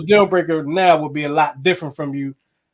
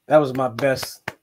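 ordinary talk, nothing marked

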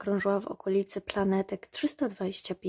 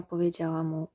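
ordinary delivery

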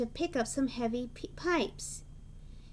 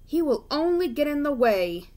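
louder, leaning on only; a proud engine scoffing; scornful; 7 dB louder than usual. A narrator's reading. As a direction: wave the crane off with contempt. Gordon scoffing.

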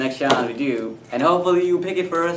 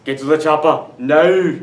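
put-on Arnold accent; 6 dB louder than usual. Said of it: "Arnold Schwarzenegger".

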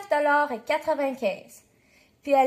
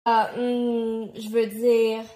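low hum, searching for words; stall, then correct yourself sheepishly; hesitant backtracking; 5 semitones lower, much slower than usual. A narrator's reading. Dad caught out by his daughter, hesitant backtracking.

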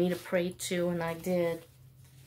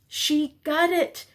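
excited; 8 semitones higher, 3 dB louder, slower than usual. Real, not acted. Announcing daughter's new job.

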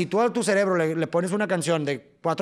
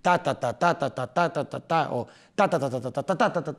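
vocal rhythm demo. Shown as a demonstration. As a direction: drum out "ta" syllables in accented groups. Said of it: "No todas es tarararara".

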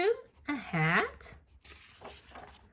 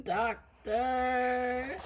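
ordinary delivery, nothing marked

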